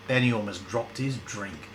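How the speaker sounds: ordinary delivery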